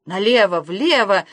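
squealed command. A narrator's reading. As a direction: call out urgent steering directions, a mild squeal. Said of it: "взвизгнул Ангала".

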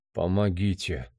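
low, soft cry for help; subdued, drained plea; weak plea aloud. A narrator's reading. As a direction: ask for help feebly. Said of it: "сказал он вслух".

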